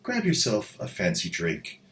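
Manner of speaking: put-on soft, snooty whispered upper-class accent; hoity-toity posh accent. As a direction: purr it like a pampered aristocrat. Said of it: "all hoity-toity accents aside".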